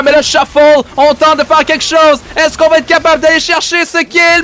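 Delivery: rising, fast excited casting; excited play-by-play. Acted. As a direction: build the hype as the fight unfolds. Live casting of a teamfight.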